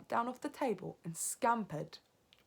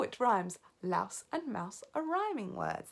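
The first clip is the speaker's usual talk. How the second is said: usual speaking voice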